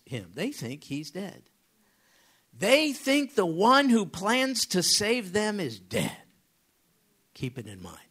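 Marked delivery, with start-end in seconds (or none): 2.5-6.2 loud, high and drawn-out proclamation; emphatic preaching. Real, not acted. Preacher driving home that the brothers think Joseph is dead, emphatic. 7.3-8.0 low, whispered reminder; hushed aside. Real, not acted. Preacher cautioning the congregation after his climax, hushed.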